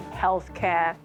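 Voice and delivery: ordinary talk, nothing marked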